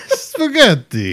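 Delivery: put-on slow, laughing Russian accent; fake Russian accent. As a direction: drag the word out in a Russian accent. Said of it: "I only speak Russian now".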